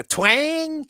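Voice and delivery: drawn-out Southern twang. Shown as a demonstration. Listing kinds of Southern speakers, mock twang. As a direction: stretch it into a twangy Southern vowel. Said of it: "you got the people with the, with the twang".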